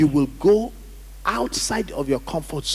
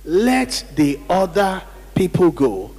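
"Let the other people go" slowly, each word given weight; emphatic repeat; 3 semitones higher, 4 dB louder than usual. Real, not acted. Preacher leading the congregation to say it again, emphatic. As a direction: hammer out "Let the other people go" at a slow, deliberate pace. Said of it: "Say it again".

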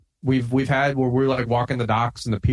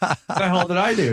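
baffled outrage; 7 semitones higher than usual. Retold or imitated. Baffled reply to punch threat.

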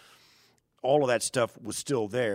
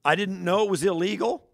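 mock clueless excuse; 8 semitones higher, 5 dB louder than usual. Imitating a clueless traveler.

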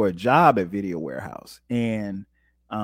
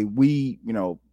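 normal talking voice

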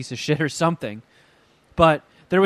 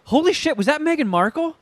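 shocked; 11 semitones higher than usual. Retold or imitated. Retold shock at seeing Meghan.

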